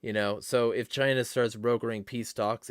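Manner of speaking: usual speaking voice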